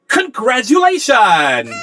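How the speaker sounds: high, loud, drawn-out congratulatory yell; Anna's yell. Acted. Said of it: "is there and yells".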